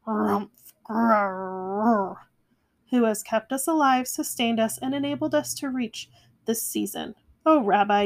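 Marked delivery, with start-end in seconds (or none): 0.1-2.2 grumbling growl. A narrator's reading. Old Bear grumbles through the blessings, growling. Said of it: "Old Bear muttered and growled".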